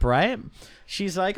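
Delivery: usual speaking voice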